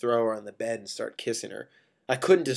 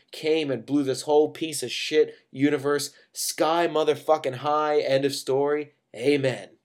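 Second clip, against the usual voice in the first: louder, mounting spoken-word list; impassioned story rant; 3 semitones higher, 6 dB louder than usual. A narrator's reading. Narrator fantasizes about driving to world's end, impassioned. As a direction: pile up the images loudly.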